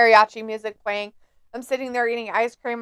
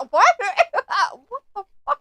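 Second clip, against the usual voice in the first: usual speaking voice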